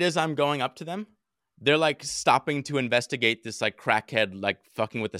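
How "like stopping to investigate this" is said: usual speaking voice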